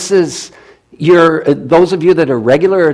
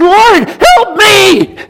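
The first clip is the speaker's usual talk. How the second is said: high, loud, drawn-out shout for help; desperate cry; 10 dB louder, much slower than usual. Acted. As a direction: cry out like a drowning man. Peter sinking, cries for help.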